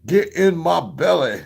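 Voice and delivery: a hint of a hungry ogre; mock greedy voice. Mock monster line.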